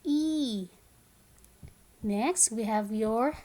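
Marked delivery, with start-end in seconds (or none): 0.0-0.7 higher, sustained "E"; long E sound. Shown as a demonstration. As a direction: hold "E" as "eee". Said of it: "It is the long sound".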